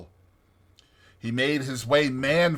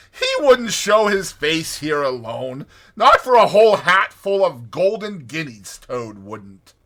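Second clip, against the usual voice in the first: scoffing; 5 semitones higher, 10 dB louder than usual. A narrator's reading. Rat scoffing at Toad.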